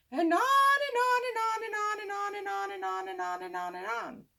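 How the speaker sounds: sing-song the endless on and on; mock storyteller. Boy mocking an endless story, high sing-song voice.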